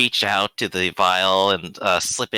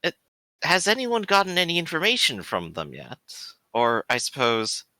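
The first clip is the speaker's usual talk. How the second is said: higher-pitched, inquisitive priest; Father Leon; 8 semitones higher than usual. Acted. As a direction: tentatively raise one more question as a priest. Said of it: "he's gonna kinda turn back and go".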